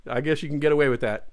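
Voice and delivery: ordinary talk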